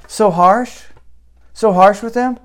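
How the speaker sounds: high-pitched incredulous questioning; incredulous echo. Retold or imitated. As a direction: throw the accusation back as a disbelieving question. Retold rebuttal to a question.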